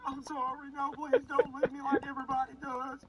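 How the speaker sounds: put-on high pleading voice with a light Southern accent; a hint of a Southern whine. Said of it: "I don't know why he's Southern now".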